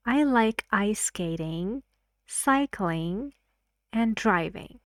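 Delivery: "ice skating" and "cycling" rise, "and driving" falls; rising list intonation. Shown as a demonstration. Teacher gives a list example, rising-rising-falling intonation. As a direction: let "skating" and "cycling" climb, then land "driving". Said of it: "rising, rising, and the last word".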